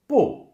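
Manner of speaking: loud "bu" starting high and dropping sharply; fourth tone, falling. Shown as a demonstration. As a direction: hit "bu" high, then let it fall. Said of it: "all the four tones with bu".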